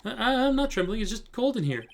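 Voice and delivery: put-on high, stammering young lawyer; nervous rookie. Rookie lawyer denying her trembling to mentor, nervously. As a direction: deny it nervously, pitched right up.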